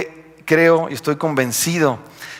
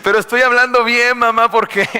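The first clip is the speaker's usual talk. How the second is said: mock sad; 9 semitones higher, 3 dB louder than usual. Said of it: "Yo así todo triste de".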